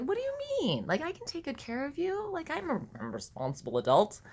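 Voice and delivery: very high-pitched, mock-incredulous protest; indignant protest. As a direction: protest in a squeaky, acted disbelief. Protesting child's refusal, acted.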